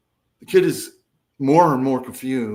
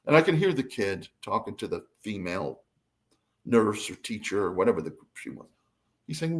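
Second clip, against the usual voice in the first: ordinary talk, nothing marked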